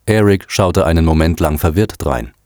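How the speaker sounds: plain reading voice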